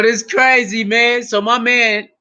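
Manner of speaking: ordinary talk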